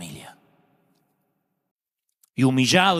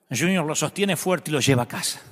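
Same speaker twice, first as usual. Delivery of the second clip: ordinary delivery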